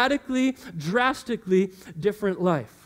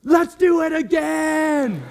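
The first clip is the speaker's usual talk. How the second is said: put-on thrilled kid shout; 6 semitones higher, 5 dB louder, much slower than usual. Daughter thrilled after the slide.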